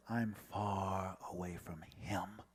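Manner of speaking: breathy hushed whisper, slow; whispered thought. Retold or imitated. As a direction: barely voice the inner thought.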